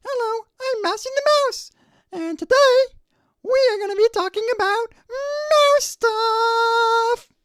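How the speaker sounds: very high, loud cartoon mouse voice, slowing at the end; cheerful little mouse greeting his audience; Mousy the Mouse. Shown as a demonstration. Engineer records the mouse line properly, loud very high cartoon mouse. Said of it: "I do the proper thing".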